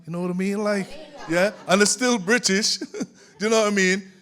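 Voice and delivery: amused, laughing aloud. Real, not acted. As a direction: chuckle while making the point. Laughing at own point about accents.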